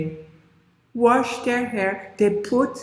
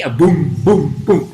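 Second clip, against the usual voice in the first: booming footsteps; 8 semitones lower, 6 dB louder than usual. Acted.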